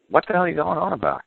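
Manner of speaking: exasperated "what on earth" put-on; mock bafflement. Acting out baffled court reacting to gibberish.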